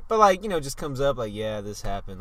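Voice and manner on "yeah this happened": offhand, lower and softer; casual passing mention. Retold or imitated. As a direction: drop it in casually like it's nothing. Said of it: "like, casually".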